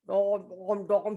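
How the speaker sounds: low heavy dom dom; stomping footsteps. Acted. Elephant walking to the truck, low heavy stomps. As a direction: thud like big elephant feet.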